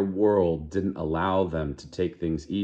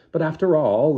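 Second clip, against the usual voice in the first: high, louder protest; 9 semitones higher, 7 dB louder than usual. Acted. Lenina protesting to Fanny, in a high, louder indignant voice. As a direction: push back, indignant and defensive. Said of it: "Lenina was protesting".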